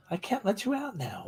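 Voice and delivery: high soft voice for the dog; soft high pet voice. Real, not acted. Telling the dog to wait.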